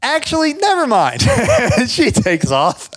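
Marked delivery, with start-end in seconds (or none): 0.0-1.2 much higher, awkward retreat; politely bail out; backing-out neighbor. Retold or imitated. Rich neighbor awkwardly withdrawing her job offer, voiced much higher. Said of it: "she's like".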